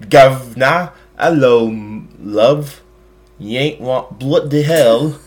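exaggerated fake British accent, loud and slowing at the end; over-the-top British caricature, playing it for laughs. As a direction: ham up a British cliché, slowing right down on the last phrase. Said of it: "Is that stereotypical? Sorry if anyone's listening from the U K".

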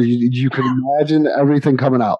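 normal talking voice

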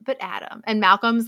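usual speaking voice

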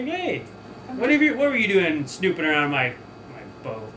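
captain voice. Acted. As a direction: confront the snoop from up high. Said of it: "And he's like".

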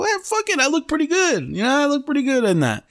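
very high, squeaky pitch; mock self-approval. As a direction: shrug it off in a comic high voice. Quoting his own cocky self-assessment.

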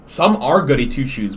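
usual speaking voice

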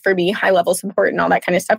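ordinary delivery

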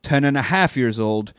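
ordinary talk

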